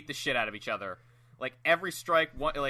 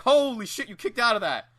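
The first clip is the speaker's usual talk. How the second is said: higher, louder mock astonishment; amazed referee; 7 semitones higher, 7 dB louder than usual. Referee popping off at a kickout, amazed outburst. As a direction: exclaim like a ref who can't believe it.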